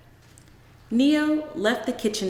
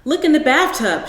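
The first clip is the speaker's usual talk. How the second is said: loud, higher commanding call; sharp order; 6 semitones higher, 8 dB louder than usual. Acted. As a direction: order him from the hallway. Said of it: "she ordered".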